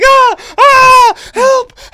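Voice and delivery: high, loud mock screams; hammed-up cries of distress; pretend screams for help. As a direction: scream as if being attacked, play-acted. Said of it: "and then she'd pretend".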